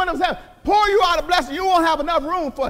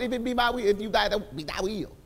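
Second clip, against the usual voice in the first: mock timid prayer; 6 semitones lower, 7 dB quieter than usual.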